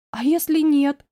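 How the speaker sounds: mildly acted tearful question; a touch of a sob, anxiously asking; sobbing. A narrator's reading. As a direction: lightly play a whimpered plea. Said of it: "всхлипнула я".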